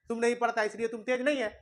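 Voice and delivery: usual speaking voice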